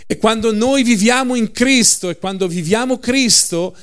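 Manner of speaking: fervent proclaimed promise; preaching at full force. Acted. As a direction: lift the congregation. Preaching climax.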